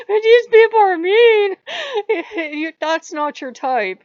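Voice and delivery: high squealing laugh through the words; cracking up mid-quote. Retold or imitated. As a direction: laugh hard while quoting. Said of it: "he's like".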